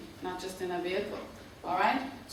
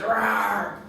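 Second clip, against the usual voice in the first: lion roar; 6 dB louder than usual. Retold or imitated. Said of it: "And the lion goes".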